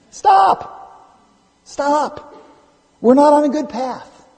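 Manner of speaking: high, forceful pulpit cry; urgent command. Acted. Preacher halting people who grumble in corners, urgent command. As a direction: call a sudden halt, pitched well up.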